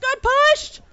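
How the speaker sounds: fast high loud rush; quick shrill relieved cry; breathless alarm. Real, not acted. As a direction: blurt out the near miss. Near fall in game.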